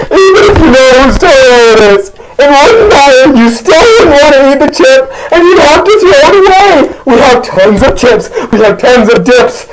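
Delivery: high, strained mock rant; hammed-up high-pitched pleading argument; exasperated arguing. Host argues homie should toss the chip, exasperated.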